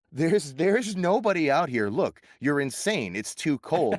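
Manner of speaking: whiny complaint. Retold or imitated. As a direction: whine at her that it's too cold. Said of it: "and I'd be like".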